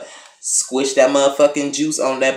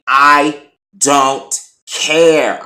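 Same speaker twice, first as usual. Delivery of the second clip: drawn-out, louder I don't care; slow defiant refusal; 3 semitones higher, 7 dB louder, much slower than usual. Retold or imitated. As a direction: drag it out to dismiss him. Tow guy refusing to move car.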